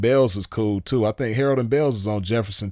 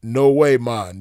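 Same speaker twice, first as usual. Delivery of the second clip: loud opening, lightly put-on Jamaican accent; mock Jamaican accent; 2 semitones higher, 7 dB louder, slower than usual. Station man refusing pennies, rough imitation Jamaican accent. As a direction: attempt a Jamaican accent, punching the first words. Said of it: "he said in his Jamaican accent".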